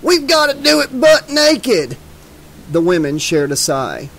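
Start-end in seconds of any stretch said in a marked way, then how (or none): 0.0-2.0 high, drawn-out rowdy yell; whooping high, stretched-out shout; excited cry. A narrator's reading. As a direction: holler it slowly to rally the guys. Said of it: "cried Duke".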